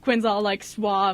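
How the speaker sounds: normal talking voice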